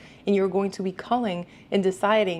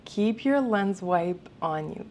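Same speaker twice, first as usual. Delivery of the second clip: normal talking voice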